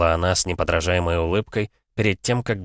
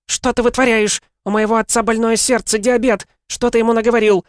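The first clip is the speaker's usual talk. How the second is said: shouting; 6 dB louder than usual. A narrator's reading. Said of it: "закричала она".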